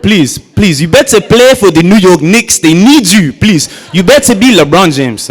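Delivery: a hint of an East African dad, loud and sarcastic; mocking dad. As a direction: beg sarcastically, as if he must be a star. Dad mocking the sneaker price.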